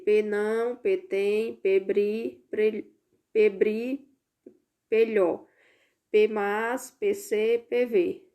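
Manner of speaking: "P não, P tem, P bri" each syllable led by a spoken P; língua do P. Shown as a demonstration. Teacher models the P game on the verses, syllable by syllable. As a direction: put a P before every syllable of "não tem brilho mas se vê". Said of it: "colocando a linguagem do P".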